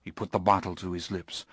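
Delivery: usual speaking voice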